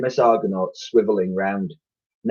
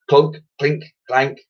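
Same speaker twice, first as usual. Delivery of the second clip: plain reading voice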